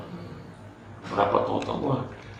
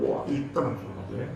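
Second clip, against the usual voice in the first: ordinary delivery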